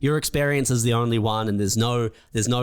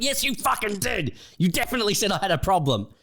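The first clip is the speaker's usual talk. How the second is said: high indignant snap; 10 semitones higher, faster than usual. Retold or imitated. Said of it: "It's like".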